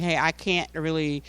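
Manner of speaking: usual speaking voice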